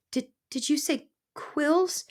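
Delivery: high, slow mouse voice; frightened stammer. A narrator's reading. Mouse hearing about the quills, high frightened stammer. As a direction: stumble in alarm, rising at the end. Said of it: "she stammered".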